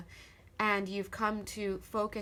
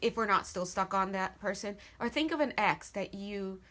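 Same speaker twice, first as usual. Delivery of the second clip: usual speaking voice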